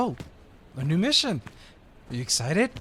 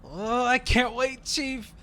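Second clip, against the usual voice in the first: sarcastic eagerness; 9 semitones higher, much slower than usual. Acted. Teasing the chief in the meeting.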